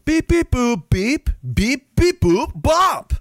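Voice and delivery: high chirpy electronic beeps; mock robot beeps. Reprogramming the migrants with beeps and boops. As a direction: bleep like a silly machine. Mimics boops and bops therapy.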